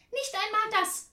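high, loud, final no; indignant refusal. A narrator's reading. Aunt rejecting the visitor's last offer, high and loud. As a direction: shut the offer down sharply.

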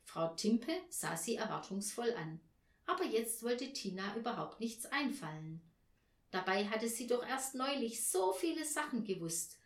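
plain reading voice

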